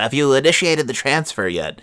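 lightly mock-formal banker question. Husband teasing his wife about the transfer, mock-formal. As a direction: lean into every syllable of initiated, stiffly polite. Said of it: "I really just wanted to say it in that voice".

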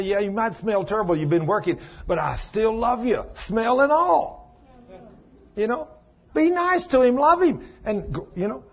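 preacher urging wives to love their husbands; peak preaching. Acted. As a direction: preach it at the top of the voice.

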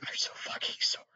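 hushed mortified whisper; breathless whispered apology. Pretend. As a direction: whisper it, deeply embarrassed. Imagined apology.

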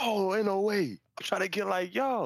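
high, giddy imitation of a starstruck woman; excited fan. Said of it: "She was like".

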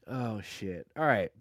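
ordinary talk

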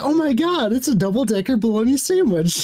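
high, thrilled and gushing; excited. Retold or imitated. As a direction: squeal it with delight. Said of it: "He's so excited too".

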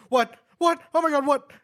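high, loud panicked imitation; mock freaked out. Teasing friend imitating his scared reaction, mock panic. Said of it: "I see your eyes just like".

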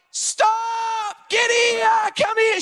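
high-pitched mock yell after a thief; high, commanding chase yell, acted out; imitated yell. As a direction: imitate wife hollering down an alley. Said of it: "She's yelling out after him".

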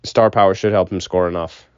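ordinary talk

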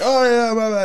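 put-on carefree drunk. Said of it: "but I was like".

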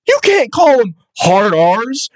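put-on drawn-out kid protest; imitated protesting kid. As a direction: object slowly in a kid's voice. Voicing kid protesting to adult.

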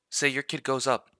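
usual speaking voice

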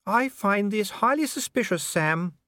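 high, knowing lady; a wife doubting her husband; suspicious Lady Sybil. A narrator's reading.

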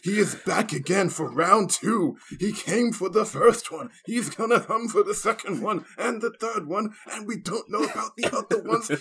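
high, loud, building hype; hype host. Pretend. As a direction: hype the crowd up. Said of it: "You ready for the remix?".

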